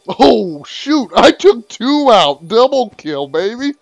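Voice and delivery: ordinary talk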